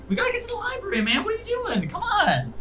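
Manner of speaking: very high, squeaky panicked voice; a tiny goblin babbling his excuses; flustered little creature. Acted. Kruk hurries the other.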